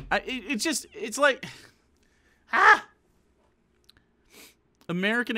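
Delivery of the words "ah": exasperated cry. Real, not acted. Exasperated outburst.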